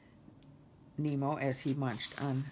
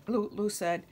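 plain reading voice

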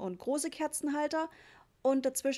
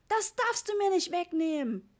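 high, loud whining protest; tearful-sounding kid's complaint; wailing child; 8 semitones higher, 5 dB louder than usual. A narrator's reading. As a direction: howl it like a little boy clinging to his gifts. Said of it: "heulte Ritchie".

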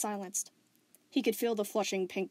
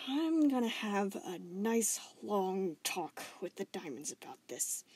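dark growl; slower than usual. Acted. Steven vowing to confront the Diamonds, dark growl. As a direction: growl the threat. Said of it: "Steven growled darkly".

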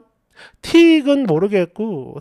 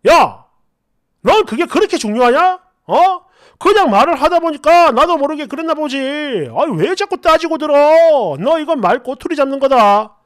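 loud, higher-pitched acted angry boyfriend; angry outburst; 3 semitones higher, 5 dB louder than usual. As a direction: lash back louder, indignant and defensive. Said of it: "더 크게 화를 내면서".

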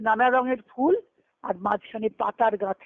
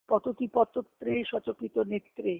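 soft slow verse recitation; 5 dB quieter, slower than usual. Retold or imitated. Quoting Jayadeva's Gita Govinda verse.

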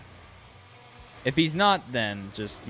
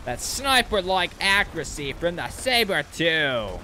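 higher, slow put-on Australian accent; 5 semitones higher, slower than usual. Streamer praising his sniper shot in a slow mock Australian accent. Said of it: "I just went Australian for a second".

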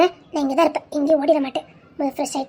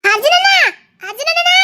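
calling out; 10 semitones higher, 5 dB louder than usual. Acted. Little sister calling brother.

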